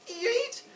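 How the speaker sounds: very high squeak; high cartoon stretch sound; tightening noise. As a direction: squeak like a string pulled taut. Sound of pulling string tight.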